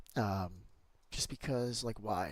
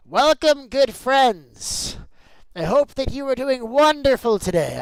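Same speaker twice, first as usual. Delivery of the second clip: high, loud showman welcome; announcer voice; 13 semitones higher, 17 dB louder, slower than usual. Acted. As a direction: greet them like a grand host. Hammed-up welcome to stream viewers.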